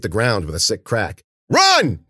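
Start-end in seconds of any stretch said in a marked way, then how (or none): 1.5-2.0 very high, loud scream; screamed command. A narrator's reading. Ben ordering the others to flee, screaming. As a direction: scream it as an urgent warning. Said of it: "Ben screamed".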